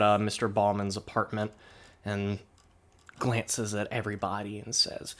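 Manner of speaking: usual speaking voice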